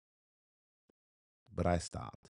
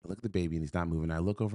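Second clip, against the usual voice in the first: usual speaking voice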